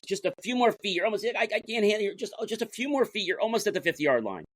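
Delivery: strained, high urging near the goal; urgent encouragement. Retold or imitated. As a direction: push him on, voice climbing high. Coach urging player at crawl.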